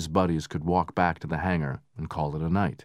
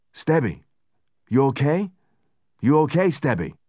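higher, loud anxious voice; worried calling; 8 semitones higher, 5 dB louder than usual. Acted. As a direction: call out to a downed friend, scared. Hurd anxiously checks dazed friend.

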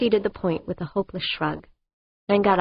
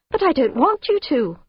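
alarmed; 8 semitones higher, 3 dB louder than usual. A narrator's reading.

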